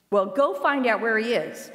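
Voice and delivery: commanding king, firm and loud; king's order. A narrator's reading. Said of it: "the king ordered".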